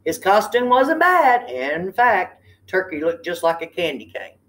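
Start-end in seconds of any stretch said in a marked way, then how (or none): none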